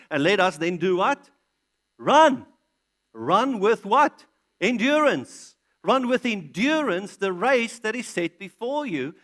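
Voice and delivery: loud, driving sermon delivery; preaching at full force. Acted. Pastor rousing the church about running the race, loud and driving. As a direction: preach it out to the congregation.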